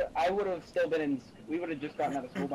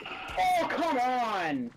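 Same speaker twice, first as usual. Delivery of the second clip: frustrated outburst; 10 semitones higher, 4 dB louder, much slower than usual. Real, not acted. Gamer frustrated at dying.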